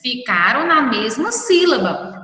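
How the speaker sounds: ordinary talk, nothing marked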